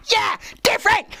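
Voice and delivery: high-pitched mock protest voice; mock defiance. Mock defiant outburst continues.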